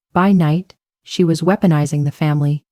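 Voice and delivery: usual speaking voice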